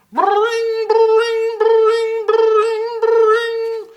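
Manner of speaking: very high, loud ringing bell; alarm bell sound. Acted. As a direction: ring it out like a bell. The station alarm bell rings.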